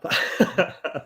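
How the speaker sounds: high laugh at own punchline; pleased storyteller's laugh. Real, not acted.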